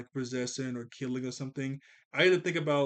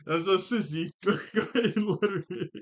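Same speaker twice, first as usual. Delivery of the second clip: laughing; 5 semitones higher, 4 dB louder than usual. Retold or imitated. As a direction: quote broken up by laughter. Repeats the quote while laughing.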